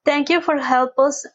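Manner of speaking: ordinary talk, nothing marked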